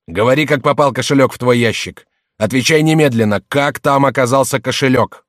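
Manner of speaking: loud, high, outraged master's rebuke; angry, commanding shout; yelling. A narrator's reading. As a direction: bellow orders at the servant. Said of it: "заорал".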